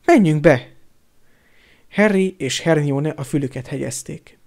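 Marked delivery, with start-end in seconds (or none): 0.1-0.7 loud, overjoyed repeated urging; boldogságtól elfúló hang. A narrator's reading. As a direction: urge them in, overcome with happiness. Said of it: "a boldogságtól elfúló hangon". (hangosan, boldogságtól elfúlva)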